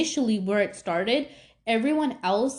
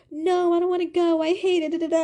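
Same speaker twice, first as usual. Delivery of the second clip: tearful protest; 7 semitones higher than usual. Retold or imitated. Daughter refusing her mom, high and whiny. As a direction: whine that you don't want to go. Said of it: "And I just started crying".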